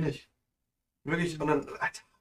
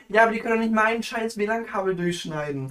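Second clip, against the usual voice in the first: angry rant; 7 semitones higher, 6 dB louder than usual. Real, not acted. Ranting about cut WLAN cable.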